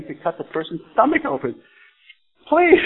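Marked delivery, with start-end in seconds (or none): 2.5-2.8 high, loud alarmed yell; yelling for police. Retold or imitated. Baron in the operating room yells for help, yelling. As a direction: cry out for help at the top of the voice. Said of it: "He starts yelling".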